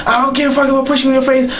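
high, rapid mock girlfriend snap; fast high retort. Girlfriend shouting back at him, high and fast. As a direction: fire it back high and quick. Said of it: "She pushed me".

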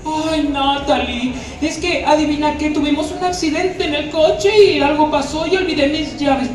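[name] mocking Adrian. Shown as a demonstration. [name] imitating Adrian to Natalie, high and mocking. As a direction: parody your cousin's niceness. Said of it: "más grosero".

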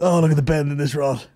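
lightly imitated fishing-show exclamation; mimicking the angler. Podcaster parroting a fishing clip, light mimicry.

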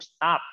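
"stop" with a released t at the end, one syllable; model of stopped. Shown as a demonstration. Teacher has the pupil repeat, "stop" with a t ending. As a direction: model it in one beat, "stop" plus final t. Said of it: "ออกเสียงสิลูก stop".

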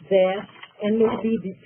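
ordinary talk, nothing marked

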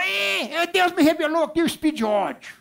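high-pitched put-on voice; excitable church brother shouting. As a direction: mimic a little brother bursting with revelation. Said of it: "aí o irmãozinho".

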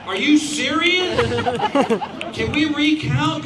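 high, loud disbelieving laugh; laughing in stunned disbelief; can't believe he survived the vote; incredulous. Retold or imitated. Retelling disbelief at result.

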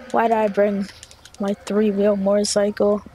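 normal talking voice

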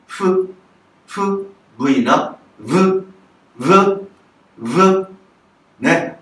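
F as a breathy "fu", V buzzing, L as a clear "ru"; consonant drills. Shown as a demonstration. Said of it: "いろいろな発音がありますね".

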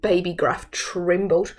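ordinary delivery, nothing marked

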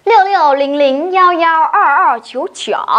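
each Mandarin digit said twice in a row; Chinese digits, paired. Shown as a demonstration. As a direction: read the pairs digit by digit. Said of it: "hai số chín đi liền nhau".

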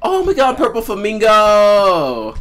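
high, slow delighted exclaim; excited. Real, not acted. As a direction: gasp in delight at the find. Sudden find in game.

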